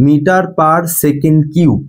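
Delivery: "Metre পার second" read out slowly, each word apart; slow, careful teacher demo; slow unit reading. Shown as a demonstration. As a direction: model how the unit is read aloud. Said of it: "পার কথাটাকে আগে উচ্চারণ করব".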